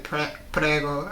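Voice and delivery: ordinary talk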